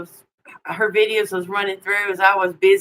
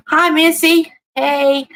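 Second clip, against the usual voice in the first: bright greeting; 10 semitones higher, 4 dB louder, slower than usual. Real, not acted. Greeting a viewer warmly.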